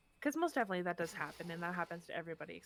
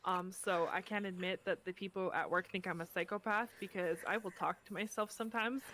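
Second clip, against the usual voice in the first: normal talking voice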